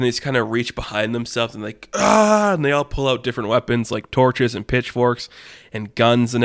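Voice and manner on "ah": high, loud "ah!"; comic minion war yell; minion battle cry. Retold or imitated. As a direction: burst out in a high cartoon scream. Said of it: "in classic minion fashion".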